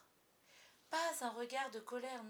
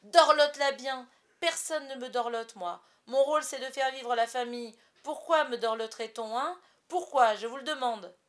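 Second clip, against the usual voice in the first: much louder, ranting shout; self-pitying shout; 3 semitones higher, 11 dB louder than usual. A narrator's reading. Father ranting at his family, loud self-pity. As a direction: rant that nobody pampers him. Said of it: "cria-t-il".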